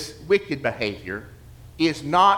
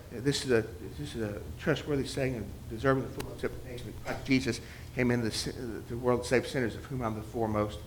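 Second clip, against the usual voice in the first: soft, fast muttered verse; muttered recitation; 5 semitones lower, 10 dB quieter, faster than usual. Retold or imitated. Memorizing a verse in the grocery line, muttered fast. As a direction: run the verse under your breath quickly. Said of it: "Imagine yourself".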